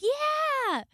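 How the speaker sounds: high, loud cheer; excited yell. Retold or imitated. Coworker cheering that it's podcast day, excited. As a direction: whoop it out.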